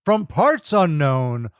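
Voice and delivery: slow booming ring announcer call; drawn-out ring announcer intro. Retold or imitated. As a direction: stretch it out like an arena announcer. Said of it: "Tony Chimmel says".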